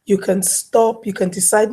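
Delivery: ordinary talk, nothing marked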